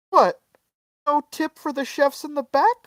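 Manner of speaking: high-pitched, incredulous imitation; mock indignant owner. Owner grabbing the tip jar, high mock indignation. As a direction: imitate an affronted demand in a high voice.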